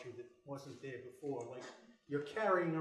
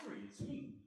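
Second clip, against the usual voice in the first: whispering; 10 semitones higher, 7 dB quieter than usual. Acted. Pleading again, hushed.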